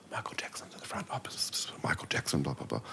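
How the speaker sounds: hushed excited whisper; breathless whispered gossip down the plane; whispering passengers. Retold or imitated.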